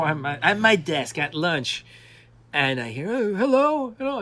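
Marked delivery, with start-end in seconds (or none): 3.1-4.2 put-on high, loud, delighted old-gentleman greeting; imitating Harryhausen. Elderly visitor calling hello at a desk, high and loud. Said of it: "and that's Harryhausen".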